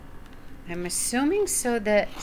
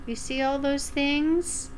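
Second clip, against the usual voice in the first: plain reading voice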